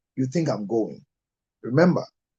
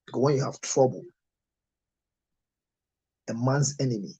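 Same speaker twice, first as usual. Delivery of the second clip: normal talking voice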